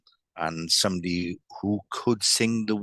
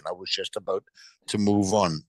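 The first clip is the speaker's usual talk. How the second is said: ordinary talk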